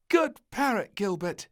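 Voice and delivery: put-on high, bright little girl voice; little princess praising her teddy parrot, grinning. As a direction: say it with a big grin. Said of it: "she grinned".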